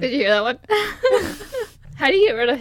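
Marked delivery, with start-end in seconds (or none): 0.0-1.4 laughing. Real, not acted. Laughing at her own hiccup.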